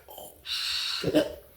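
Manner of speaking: shocked breathy whisper. Retold or imitated. Watching the standoff, a shocked whisper. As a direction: hush it, stunned. His stunned reaction.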